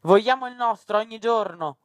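high-pitched shouted demand; defiant shout. A narrator's reading. Rosa Spina from the town hall balcony, shouting defiantly. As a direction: proclaim it from a balcony. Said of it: "urlò Rosa Spina dal balcone".

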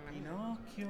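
gentle wake-up call. Acted. Waking Pinocchio gently.

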